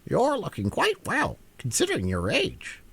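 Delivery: high, pert hen voice with a sly jab; cheeky hen. Acted. Hen teasing the Queen about her age, pert and high.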